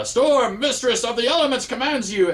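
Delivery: high, stilted, grandly theatrical proclamation; stilted Storm voice. Acted. Reviewer imitating Storm's silly dialogue, stilted high voice. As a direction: declaim it like a pompous cartoon heroine. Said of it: "talk in a very stilted, uh, voice".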